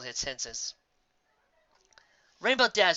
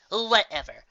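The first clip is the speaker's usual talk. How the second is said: brush-off Whatever, a bit louder; dismissive; 6 semitones higher, 4 dB louder, slower than usual. A narrator's reading. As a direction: wave it away. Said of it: "in a dismissive rap".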